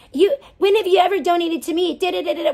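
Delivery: mocking imitation. Streamer mimicking Beta asking about donations, whiny mock voice. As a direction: parrot her whining with contempt. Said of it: "And then how she's like".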